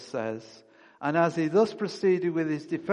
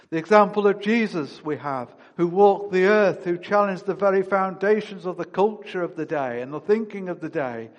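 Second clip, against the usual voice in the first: louder, higher preaching climax; rising fervour; 4 semitones higher, 6 dB louder than usual. Real, not acted. Preacher telling how the apostles challenged culture, fervent louder climax. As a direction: lift it up with fervour.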